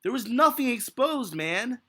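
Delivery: ordinary talk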